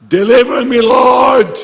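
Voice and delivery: high, loud anguished cry. Acted. Preacher voicing the believer's plea, shouted high and loud. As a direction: cry out to God for rescue.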